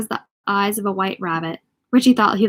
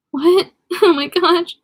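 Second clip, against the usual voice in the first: amused disbelief; 8 semitones higher, 5 dB louder, slower than usual. Real, not acted. Reader reacts to odd line.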